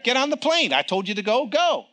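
commanding God. Retold or imitated. God telling the preacher to board, commanding. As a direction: order him aboard. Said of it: "that's how he talks to me".

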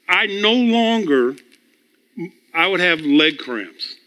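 ordinary delivery

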